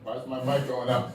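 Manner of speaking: usual speaking voice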